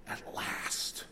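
breathless whispered wonder; awed whisper. Acted. Adam whispering in awe at first sight of Eve.

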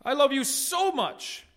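sweet mock lover's "so much"; emphatic so. Shown as a demonstration. Preacher mimicking everyday emphatic "so". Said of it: "It's, it's an emphatic word".

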